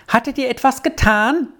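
high-pitched anxious question called to Arthur; calling out. A narrator's reading. Squirrel waits outside the door for Arthur, calling out. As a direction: call it out high, no real shout. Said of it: "rief sie ihm entgegen".

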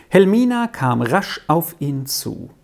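ordinary delivery

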